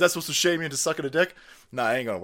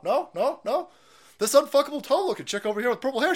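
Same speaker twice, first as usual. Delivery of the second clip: ordinary talk, nothing marked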